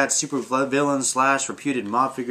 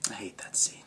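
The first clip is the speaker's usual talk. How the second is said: whisper; 3 semitones lower, 10 dB quieter, faster than usual. Shown as a demonstration. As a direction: whisper "I hate that scene" with no voice at all. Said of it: "Oh, you're gonna do this?".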